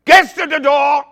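high, loud greeter's shout. Retold or imitated. Restaurant staff announcing new customers, shouting. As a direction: yell it out across the restaurant. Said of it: "someone starts shouting".

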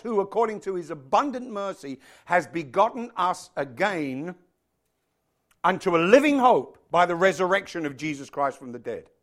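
forceful, emphatic sermon delivery; preaching. Real, not acted. Preacher telling congregation faith comes from hearing God, emphatic preaching. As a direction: preach it, leaning hard on key words.

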